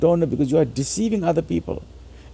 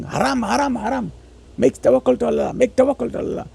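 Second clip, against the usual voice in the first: mock forbidding cleric; 8 semitones higher than usual. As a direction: parody a scholar crying haram. Mocking ulama forbidding vaccines.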